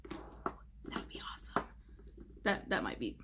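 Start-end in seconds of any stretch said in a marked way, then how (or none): 0.1-1.7 hushed, voiceless aside; whispered musing. Real, not acted. Vlogger musing on a neon look, whispered. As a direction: whisper it as a private thought.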